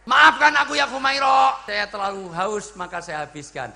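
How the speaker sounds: high declaimed cry, gradually settling; dramatised loud apology. Acted. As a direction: call out the plea, then bring it down. Acts out retold apology.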